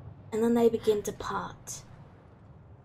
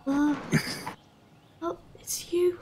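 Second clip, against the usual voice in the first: higher-pitched halting gasps of recognition; overwhelmed recognition; 8 semitones higher, much slower than usual. Acted. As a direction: let disbelief break into a little laugh. Acted emotional reunion with lost sister.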